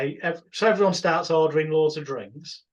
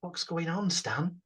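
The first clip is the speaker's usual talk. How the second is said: dead quiet; 9 dB quieter, slower than usual. Retold or imitated. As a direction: lean in and ask it under your breath. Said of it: "I let him dead quiet".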